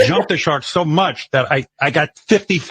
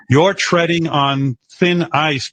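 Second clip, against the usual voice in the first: mock warning; 5 semitones higher, much slower than usual. Husband reads out a follower's warning to his wife, mockingly. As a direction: spell out the threat ponderously.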